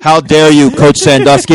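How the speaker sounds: loud, indignant scolding; loud, mock indignant shout; mock outrage.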